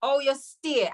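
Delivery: "Oh, you stay" with a long open "oh" and falling tune; patois "Oh, you stay" taught slowly; patois greeting. Shown as a demonstration. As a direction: say "stay" clearly, Jamaican style. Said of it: "How you see it?".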